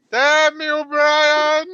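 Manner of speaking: high, loud, drawn-out mock wail; loud comic cry of blame; mock cursing. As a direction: curse them in a long playful howl. Mock cursing a fellow player.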